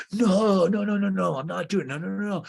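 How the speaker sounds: high, rapid string of refusals; mock protest. Tom refuses to be set up on a date, mock protest. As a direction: imitate a panicked refusal, loud at first.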